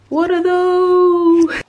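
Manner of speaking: high, drawn-out holler; exaggerated meme-style yell; mock outcry. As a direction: shout it long and high, as a joke.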